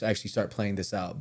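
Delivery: ordinary talk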